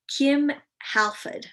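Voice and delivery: a quick, lightly done Australian take on "Kim Halford"; Australian accent. Shown as a demonstration. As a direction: say "Kim Halford" like an Aussie, dropping the r in "Halford". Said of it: "that's how we're gonna say it".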